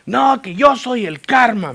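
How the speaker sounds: high, loud, slow mock bellow; pompous, heated retort, hammed-up; imitated angry shout. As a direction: imitate an irate driver proclaiming himself. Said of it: "me decía, así me decía".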